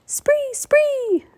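very high squawking shrieks; raven shrieks. Acted. Chorus of ravens.

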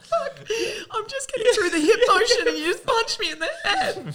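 high, laughing mock protest; indignant high-pitched complaint through laughter; mock outrage. Boxer griping to whoever just punched her, hammed-up outrage. Acting out being punched while boxing.

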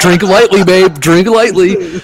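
high, loud urgent hiss of a warning. Retold or imitated. Husband warning his wife at the drug lord's party, loud and high. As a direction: warn her to drink lightly, loud and high. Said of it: "I'm thinking, I'm like".

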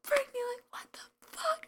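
high, hushed whisper of disbelief; whisper-scream it as the exasperated boyfriend; whispered mock outrage. Boyfriend to girlfriend after fries fly everywhere, whispered mock outrage. Boyfriend's angry reaction in story.

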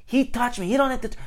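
high-pitched whiny protest imitation; mock indignant complaint. Customer complaining that the clerk touched him, high mock indignation.

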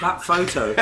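usual speaking voice

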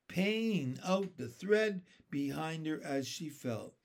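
usual speaking voice

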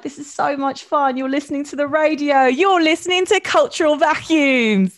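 loud, high South London radio presenter; big, hyped-up station ident delivery; Kiss radio ident. Acted. Guest doing a radio ident on request, hyped presenter voice. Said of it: "say it like a Kiss ... ident".

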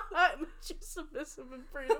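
cracking up at your own joke; barely holding back the giggles; laughing. Real, not acted. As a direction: say it through real laughter.

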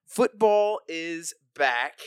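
high, loud, slow announcement; excited announcement. Real, not acted. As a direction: proclaim it to the crowd. Big announcement.

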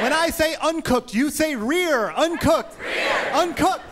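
high shouted chant; rally the crowd, shouting high; cheer call. Acted.

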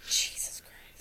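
breathy exasperated whisper. Real, not acted. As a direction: whisper it under your breath. Exasperated aside.

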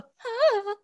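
high, soft hammed-up faint; a mock damsel sigh; mock swoon sigh. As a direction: pretend fainting gasp. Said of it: "I don't go".

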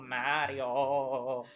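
a hint of whiny Luigi; lightly voiced Luigi calling out. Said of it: "that's absolutely what Luigi sounds like".

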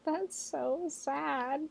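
high, tiny, drawn-out whimper of pity; hushed, high-pitched pity; mock heartbroken. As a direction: say it slowly and softly, aching with sympathy. Reacting to lonely party story.

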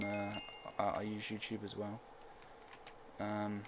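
ordinary delivery

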